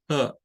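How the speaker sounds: "G" said as a short "huh"; HUH syllable. Shown as a demonstration. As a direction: make the vowel of "G" an UH. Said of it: "dos pronunciaciones para la primera sílaba".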